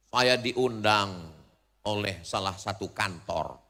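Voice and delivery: normal talking voice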